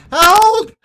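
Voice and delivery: high, loud desperate cries for help; noble screaming for help. Acted. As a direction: shriek for help like a panicked noble. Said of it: "the noble continues to scream for help".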